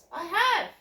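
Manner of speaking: loud insisting cry; emphatic outburst. Real, not acted. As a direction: burst out with it. Emphatic insistence after beaten up.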